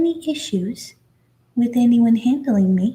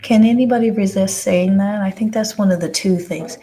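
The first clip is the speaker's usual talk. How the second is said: ordinary talk, nothing marked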